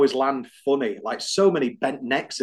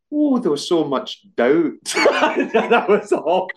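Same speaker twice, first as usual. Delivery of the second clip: Robin Williams voice; 5 semitones higher, 4 dB louder, much slower than usual. Retold or imitated. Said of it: "You got Robin Williams on the brain".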